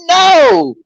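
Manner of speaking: very high, loud cry of refusal; mock horrified shout. Reacting to people surfing in a hurricane, high mock horrified shout. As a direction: shout it in hammed-up dismay. Acted horrified reply to reckless idea.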